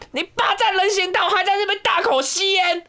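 hammed-up high-pitched scolding, slow and drawn out; crazy woman yelling. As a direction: squeal it way up like the unhinged lady scolding. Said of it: "然后他就说".

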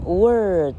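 Word stretched as "werrrd"; slow model of Word with a long vowel; long r-coloured vowel. Shown as a demonstration. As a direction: hold the curled "er" in Word. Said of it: "Word也是这样念。".

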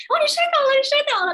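thrilled at the chance to try it out; excited, not worried. Retold or imitated. Mother to her fallen daughter, giddy excitement. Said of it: "所以我就很开心".

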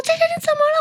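high, worried younger-sister voice; little sister calling. Retold or imitated. Younger sister calling from the shower to her big sister, high and worried. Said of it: "她说".

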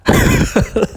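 sudden shrill-pitched laugh; high laugh at his own story; high burst of laughter. Real, not acted. Laughing at the absurdity.

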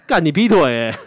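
loud indignant curse; angry outburst. Real, not acted. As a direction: spit it out in outrage. Venting at cheating ex. (愤怒地骂)

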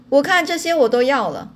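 narrator's restrained version of a loud declaration; mild shout. A narrator's reading. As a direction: call it out, lightly hammed-up. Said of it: "他大声嚷着".

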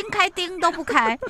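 high-pitched comic complaint; exasperated, amused retelling; mock-angry dad. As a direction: imitate the exasperated father. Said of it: "后来爸爸就生气了".

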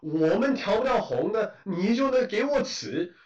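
mock her shameless logic; self-righteous. Imitating the fruit-taker justifying herself, self-righteous. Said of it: "理直气壮说".

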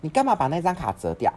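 high, loud mock scolding; nagging scolding. Mother scolding her son over a bent card, nagging. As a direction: imitate a mother telling someone off. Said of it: "我妈就在那边念说".

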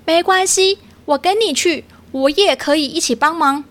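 high, eager little otter voice. Acted. Otter offering to help owl, eager. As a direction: jump in to volunteer.

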